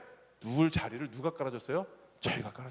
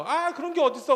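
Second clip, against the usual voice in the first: put-on much higher, whiny kid protest; protesting child; 10 semitones higher, 3 dB louder than usual. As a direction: complain like a spoiled child. (떼쓰는 아이 목소리)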